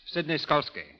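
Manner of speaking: usual speaking voice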